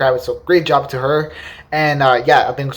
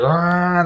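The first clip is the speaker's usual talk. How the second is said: a lightly voiced, higher growling rev of a car engine; engine roar imitation; 4 semitones higher than usual. Imitating the Batmobile engine for the viewers, higher growling rev. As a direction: rumble it out like the Batmobile motor revving. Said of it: "the motor was, like, super loud".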